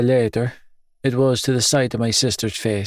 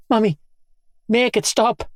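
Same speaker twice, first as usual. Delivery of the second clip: put-on small child; 11 semitones higher than usual.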